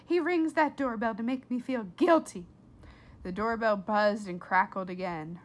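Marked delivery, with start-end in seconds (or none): 0.0-2.5 louder, exasperated mother; mother's complaint. A narrator's reading. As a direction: complain like an annoyed mom. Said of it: "said Flora's mother".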